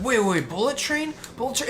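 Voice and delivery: high, questioning double take; half-mocking startled confusion; confused surprise. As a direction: replay a startled mix-up. Confused at bus driver's words.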